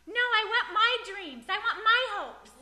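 mock a stubborn believer who clings to his wishes; whiny stubborn believer. Preacher mocking a stubborn believer, whiny.

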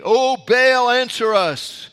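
loud, high, drawn-out plea to Baal; crying out. Acted. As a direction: call out to a silent god, stretching the words.

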